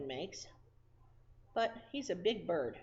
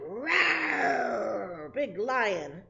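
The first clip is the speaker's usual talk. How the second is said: loud playful roar; mock lion roar for little kids; 3 semitones higher, 7 dB louder, much slower than usual. Teacher voicing lion roar.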